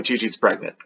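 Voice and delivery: usual speaking voice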